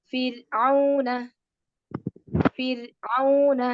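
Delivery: slow ഫിർഔന with a light ra; ഫിർഔന slowly with light ra. Shown as a demonstration. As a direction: drawl ഫിർഔന, ra kept thin. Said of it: "pronounce the raw light".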